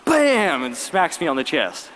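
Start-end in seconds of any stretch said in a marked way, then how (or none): 0.1-0.6 a sharp, high Bam!; punch sound effect. Retold or imitated. Teen retelling a concert fight, punch sound effect. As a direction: snap out the hit like a sound effect.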